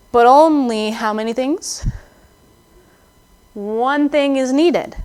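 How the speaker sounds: slow, weighty delivery of only and one thing; slow emphasis. Shown as a demonstration. Pastor quoting Jesus to Martha, slow and weighty. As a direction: lay it down slowly, each word heavy.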